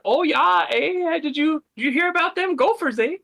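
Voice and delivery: put-on high, bouncy Minnesota accent; goofy Upper Midwest accent, up high. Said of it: "goofy Wisconsin, Minnesota accents".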